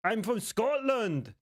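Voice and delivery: high, put-on Scottish voice; badly faked Scottish, high and insistent; attempted Scottish accent. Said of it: "maybe I could do a Scottish accent".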